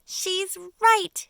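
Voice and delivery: high, loud agreeing shout; a ladybug shouting in alarm. Acted. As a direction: shout over the panic. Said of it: "shouted the ladybug".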